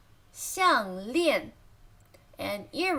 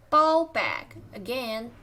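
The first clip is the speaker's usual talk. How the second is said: ordinary delivery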